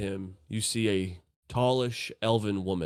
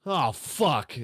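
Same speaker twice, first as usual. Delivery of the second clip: shrill startled yelp, pitched way up; startled NPC; 11 semitones higher, 4 dB louder than usual. Acted. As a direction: jump out of your skin, voice cracking high. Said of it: "Telvor's like".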